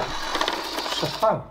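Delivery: hushed puzzled whisper. Real, not acted. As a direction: whisper as you watch closely. Watching robot, hushed.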